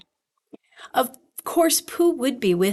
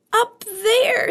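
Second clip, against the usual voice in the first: high-pitched, urgent squeak; frightened Piglet; 12 semitones higher, 3 dB louder than usual. A narrator's reading. Piglet waving his paw at the danger, frightened. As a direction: squeak it out in alarm.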